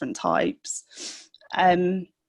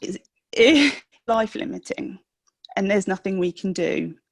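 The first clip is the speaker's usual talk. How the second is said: real emotion rising, voice catching, a teary laugh; fighting back tears while saying it; getting emotional. Real, not acted. Mother retelling her son's diagnosis, fighting back real tears. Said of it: "I'm gonna get emotional".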